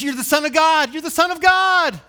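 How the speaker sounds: loud, high-pitched eager outburst; excited blurting. Acted. Peter as eager kid in class blurting the answer, excited shout. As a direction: blurt it out like an overeager pupil. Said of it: "the words come out before the hand".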